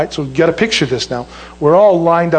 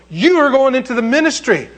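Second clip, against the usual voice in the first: raised, higher sergeant pronouncement; retold drill sergeant order; 7 semitones higher than usual. Drill sergeant jumps up at the recruit, high emphatic order. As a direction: declare it like a sergeant giving orders. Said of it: "jumps up and he goes".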